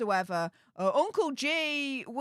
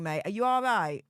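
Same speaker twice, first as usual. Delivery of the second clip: ordinary talk, nothing marked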